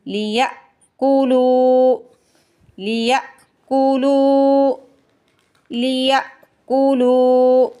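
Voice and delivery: लियअकुलू with the lam vowel drawn long; lengthened lam. Shown as a demonstration. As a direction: stretch the लू in लियअकुलू. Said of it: "लाम को खींच के अदा करेंगे". (लियअकुलू में लाम को खींच कर)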